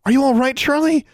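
high, loud anxious question; breathless worry. A narrator's reading. Girl rushing to her raven's cage, breathless. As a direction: call out in breathless alarm. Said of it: "she asked breathlessly".

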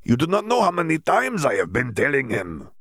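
put-on lightly accented, exasperated Bulgarian Viktor; Bulgarian-accented Victor. Victor scolding Dimitri, a hint of Bulgarian accent. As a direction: scold him with a mild Bulgarian lilt.

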